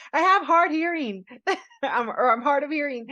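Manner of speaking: laughing retelling of a cover story. Retold or imitated. BJ's excuse, teller laughing.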